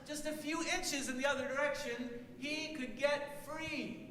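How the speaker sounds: stretched-out squeak calling up to an elephant; tiny mouse voice. A narrator's reading. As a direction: sing it up high like a tiny mouse. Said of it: "He said".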